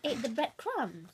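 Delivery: usual speaking voice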